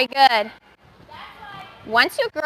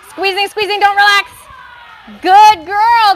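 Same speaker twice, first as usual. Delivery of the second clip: higher and louder, cheering her on; excited coaching calls; 7 semitones higher, 9 dB louder than usual. Real, not acted. Coach cheering a gymnast through her roll, loud and bright. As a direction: push her through then praise.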